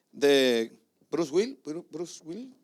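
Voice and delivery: slow, drawn-out, unsure Bruce Will-; groping for the name. Real, not acted. Preacher trying to recall the actor, drawn-out hesitation. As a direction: stretch the name out while searching for it. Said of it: "me confundo siempre".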